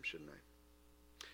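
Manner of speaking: ordinary talk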